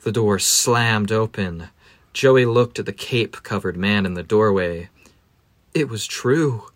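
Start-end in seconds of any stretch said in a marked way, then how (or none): none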